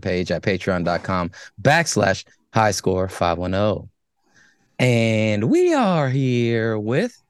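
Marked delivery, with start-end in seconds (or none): 4.8-7.2 slow stretched announcer intro with a rising end; drawn-out show intro. Acted. Show intro hosts sequence.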